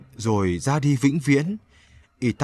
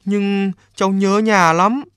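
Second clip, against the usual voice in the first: put-on homesick boy; 7 semitones higher, 3 dB louder than usual. A narrator's reading. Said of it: "Chú bé vội nói thật nhanh".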